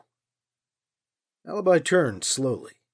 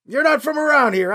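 higher, louder suspicious call; 10 semitones higher, 6 dB louder than usual. A narrator's reading. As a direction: call the question up the trail. Man calling up trail.

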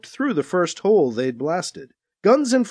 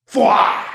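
blaster zap; 3 semitones higher, 5 dB louder than usual. Acted. Blaster sound effect in story.

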